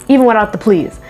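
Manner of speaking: ordinary delivery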